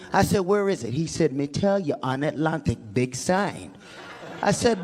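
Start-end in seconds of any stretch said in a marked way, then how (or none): 1.3-3.7 low Jamaican patois, understated; offhand imitation Jamaican; Jamaican accent. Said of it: "messing with some Jamaicans".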